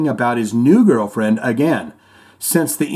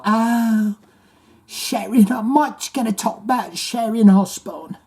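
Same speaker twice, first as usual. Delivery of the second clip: mock [name] voice; 7 semitones higher than usual. Mocking [name] calling Sharon.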